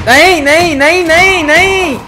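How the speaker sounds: high, loud panicked nos. Real, not acted. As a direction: yelp it as you get caught. Dying in game, panic. (घबराकर ऊँची चीख में नहीं नहीं)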